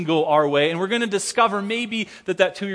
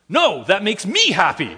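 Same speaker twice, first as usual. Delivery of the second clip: put-on higher, louder toddler contradicting; mock insistent toddler; 3 semitones higher, 6 dB louder than usual. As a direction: play the stubborn two-year-old again. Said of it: "she looks at me and says".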